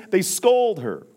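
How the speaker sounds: loud and high, scolding tone; loud indignant declaration; emphatic. Shown as a demonstration. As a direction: hit it hard like an accusation. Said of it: "repeats line, then drops low".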